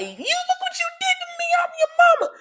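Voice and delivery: mocking mom. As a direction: imitate a guilt-tripping mother, high and piercing. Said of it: "it's like".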